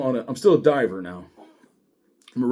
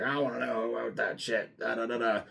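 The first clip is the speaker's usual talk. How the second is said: mocking sergeant; 9 dB quieter than usual. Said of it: "she's like".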